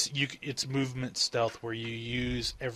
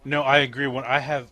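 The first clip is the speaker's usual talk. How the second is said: normal talking voice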